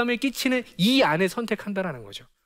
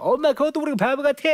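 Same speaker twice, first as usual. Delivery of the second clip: high, mocking child voice; bratty kid jeering; teasing child; 7 semitones higher than usual. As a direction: tease mom like a cheeky kid. Child teasing mom, acted quote.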